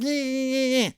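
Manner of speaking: high, thin whining screech; irritating little scream. Retold or imitated. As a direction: imitate a weird little creature whine. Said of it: "his irritating little scream".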